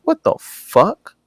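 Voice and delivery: high, drawn-out shocked disbelief; a stunned, high "what the fuck" at her request; incredulous. Retold or imitated. To the streamer asking him to say it, high shocked disbelief. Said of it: "I'm like".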